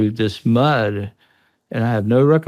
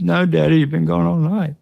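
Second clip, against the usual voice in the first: imitating scared son; 7 semitones higher than usual.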